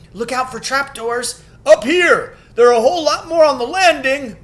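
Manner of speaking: high, loud call up the stairs. A narrator's reading. Statues shouting through the castle, high and loud. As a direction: hail the others from above. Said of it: "everyone's voices crying out at once".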